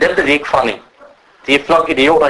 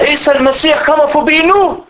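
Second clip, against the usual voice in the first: surprised villager; 10 semitones higher than usual. Retold or imitated. Villagers hear Jesus is coming.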